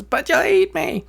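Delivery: very high, squeaky bird voice; worried little bird. A narrator's reading. Little bird afraid the wolf will eat it, high squeak. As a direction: squeak out the objection. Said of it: "Bird says".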